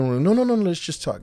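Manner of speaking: urgent pleading. Retold or imitated. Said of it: "retold pleading".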